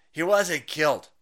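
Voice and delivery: ordinary talk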